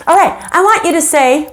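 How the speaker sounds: high, sneering schoolgirl taunt; mean bully voice. Retold or imitated. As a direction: taunt like a mean older girl. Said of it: "and she said".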